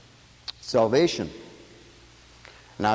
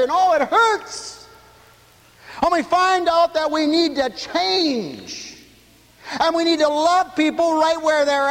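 full-force preaching; 12 semitones higher than usual. Acted. Pastor preaching scripture to the radio listeners, fervent. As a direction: preach it out to the congregation. Preaching climb over the pit.

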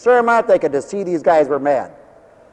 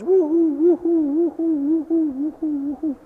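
high whoops growing softer as they run away; fading into distance; 9 semitones higher, 5 dB quieter than usual. Acted. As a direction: let the woo-hoos trail off far away. Scouts running off into distance.

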